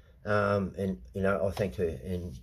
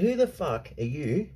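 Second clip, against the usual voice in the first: angry retold rant; 3 semitones higher than usual. Telling the man off at the front door, angry. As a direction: bark it back at him, incredulous. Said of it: "I said".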